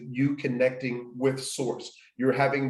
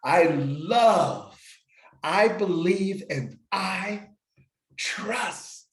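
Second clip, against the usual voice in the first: loud and clear; 5 semitones higher, much slower than usual. Shown as a demonstration. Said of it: "And say that loud and clear".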